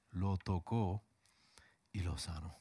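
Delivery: ordinary talk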